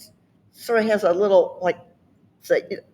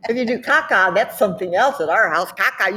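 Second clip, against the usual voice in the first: normal talking voice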